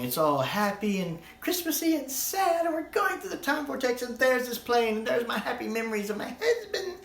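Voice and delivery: high, far sing-song parody of the mother; sappy high mother voice, lightly mocking; mock sentimental mother. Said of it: "mock voicing the mother character".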